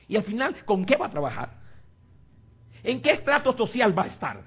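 high, loud rhetorical questions; mock anxious questioning. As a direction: imitate a worried, calculating believer, voice rising. Mimics worldly Christians' anxious questions.

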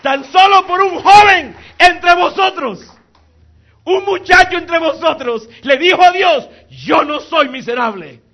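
high, very loud exultant shouting; full-force preaching. Real, not acted. Preacher proclaiming the young man's faith, full-force shouting. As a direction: shout it out to the whole congregation.

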